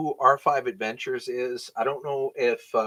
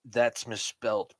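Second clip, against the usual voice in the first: usual speaking voice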